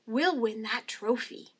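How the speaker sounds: ordinary delivery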